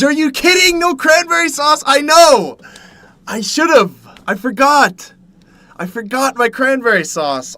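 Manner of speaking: high, loud, upset complaining; exasperated dismay. Acted. Streamer tells chat he forgot the cranberry sauce, high loud exasperation. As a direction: rant about the missing sauce.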